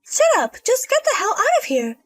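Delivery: angry outburst. Acted. Elia ordering the lazy in-law out, furious. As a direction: snap and throw her out. Elia loses her temper.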